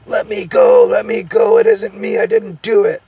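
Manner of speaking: very high, loud panicked squeal; squealing gnome. A narrator's reading. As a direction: squeal frantically to be let go. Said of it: "squealed the Earthman".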